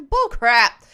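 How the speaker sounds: loud scoff. Real, not acted. Streamer scoffing at a quote tweet. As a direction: spit it out loud in scorn.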